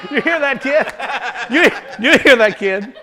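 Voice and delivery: loud high laughing jeer; laughing mockery. Jebusites laughing at David's boast. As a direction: laugh the words out in scorn. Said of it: "they're laughing".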